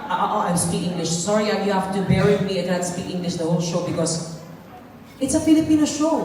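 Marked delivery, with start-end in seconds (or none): none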